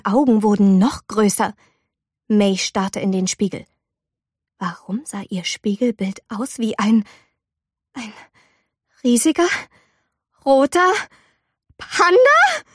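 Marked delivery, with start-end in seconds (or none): 4.6-10.9 breathless whisper; whispered disbelief. A narrator's reading. Girl staring at the mirror, whispered disbelief. Mei sees panda in mirror. 11.8-12.6 panda leaps high and louder; shocked. A narrator's reading. Girl sees a panda in the mirror, shocked high cry.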